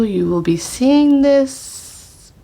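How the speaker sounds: ordinary talk, nothing marked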